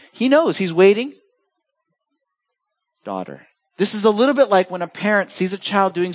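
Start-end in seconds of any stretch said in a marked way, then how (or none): 3.0-3.5 soft, hushed and tender, much quieter; calm a frightened woman with one soft word; gentle calming. Acted. Jesus calming the trembling woman, soft and tender. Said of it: "Jesus, with a word, calms her".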